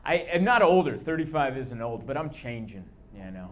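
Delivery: usual speaking voice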